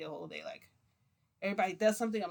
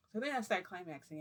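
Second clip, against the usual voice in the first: usual speaking voice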